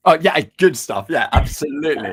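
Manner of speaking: loud, hammed-up cheer of agreement; mock-enthusiastic echo. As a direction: boom it out like an overexcited fan. Playful exaggerated echo of co-host.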